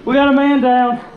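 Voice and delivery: loud call that someone's hit; man down shout. Real, not acted. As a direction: shout it to the whole team. Teammate hit, calling out.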